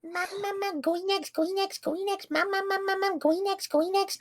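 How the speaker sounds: put-on very high squeaky baby whine. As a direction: whine like a toddler begging. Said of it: "that specific, like, voice clip".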